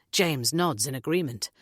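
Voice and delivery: ordinary delivery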